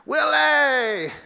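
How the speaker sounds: high, loud Obama greeting; excited greeting. Retold or imitated. Obama greets Willie, high and loud. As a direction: call his name in delight.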